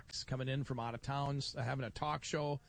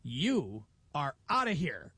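slow, firm you-are-outta-here; stern eviction; 4 dB louder, slower than usual. Retold or imitated. Fair president throws the radio crew out, slow and stern. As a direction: lay down the law to the intruder. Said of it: "He goes".

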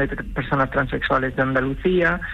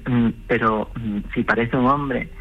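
voz más grave, dudando entre pausas; imitando un comentario dubitativo; 5 semitones lower than usual. Retold or imitated. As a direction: imita por lo bajo a quien duda. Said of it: "la lectura rara que hacen los que dicen". (imitación titubeante y más grave)

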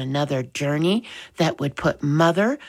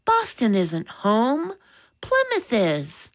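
put-on higher, earnest boy at prayer; boy's prayer; 8 semitones higher than usual. A narrator's reading. Boy praying to the Lord to stay home, earnest plea. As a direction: plead in a raised young voice. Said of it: "he whispered".

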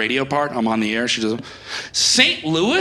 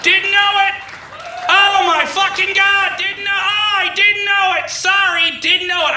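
mock outburst; 7 semitones higher than usual.

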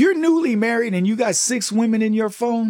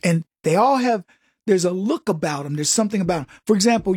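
ordinary talk, nothing marked

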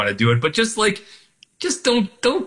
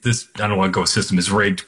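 normal talking voice

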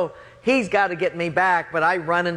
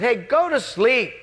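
high, annoyed call back; irritated yell; 8 semitones higher than usual. Retold or imitated. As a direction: act out hollering at a pest through the door. Annoyed, yelling back through door.

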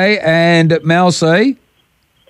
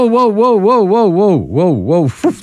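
raised, playful whoa whoa; mock alarm; 8 semitones higher than usual. Real, not acted. Host cutting off the caller's swear, mock alarm. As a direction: pretend to be shocked at the swear. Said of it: "You just let something slip there".

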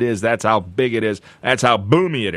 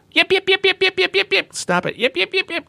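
much higher yappy little dog yips; yipping poodle. Retold or imitated. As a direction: yip like a frantic tiny poodle. Imitates poodle barking.

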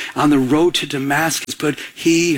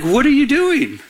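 higher-pitched retold challenge from God; pointed, raised-pitch questioning; challenging question; 7 semitones higher than usual. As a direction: call him out with the question. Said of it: "So he was like".

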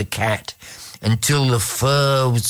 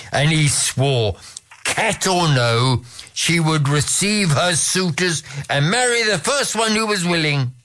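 usual speaking voice

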